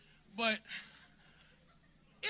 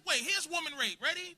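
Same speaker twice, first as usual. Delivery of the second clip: ordinary talk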